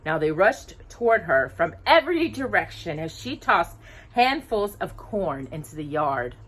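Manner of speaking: ordinary delivery, nothing marked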